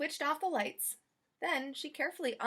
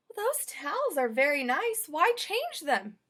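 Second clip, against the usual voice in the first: puzzled, slow maid voice; Amelia Bedelia; 5 semitones higher, 5 dB louder, slower than usual. Acted. Maid puzzled by her chores, slow. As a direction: wonder why anyone would. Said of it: "she thought".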